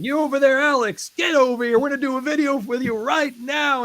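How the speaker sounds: put-on high, loud Louisville accent, calling out. [name] calling him over, loud high Louisville accent. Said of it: "in his Louisville accent".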